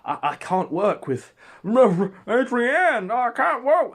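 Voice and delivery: high, loud, slow panicked whine; a rattled man falling apart; shaking and quaking. Retold or imitated. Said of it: "Andy's shaking and quaking".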